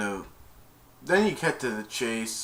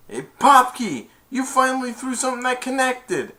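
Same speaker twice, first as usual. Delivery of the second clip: mock jeer; 14 semitones higher, 7 dB louder than usual. Mocking a teammate mid-fight.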